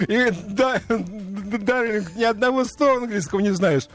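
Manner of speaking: teasing reproach. Retold or imitated. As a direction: scold a friend while laughing. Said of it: "Я говорю".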